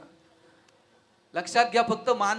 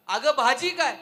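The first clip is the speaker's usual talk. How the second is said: higher, louder call from the sofa; 5 semitones higher, 8 dB louder than usual. Retold or imitated. Husband calls out from the sofa to his wife, louder. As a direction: call toward the kitchen. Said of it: "सोफ्याजवळून आवाज दिला".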